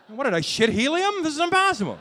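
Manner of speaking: hammed-up shock at the scale; mock disbelief. Comic on the bathroom scale, loud mock outrage.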